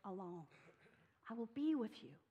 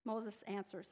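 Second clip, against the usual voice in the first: ordinary talk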